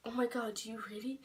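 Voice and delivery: sister's shocked whisper; whispered gasp from the sister. Retold or imitated. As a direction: hush it like a stunned sister. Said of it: "she was like".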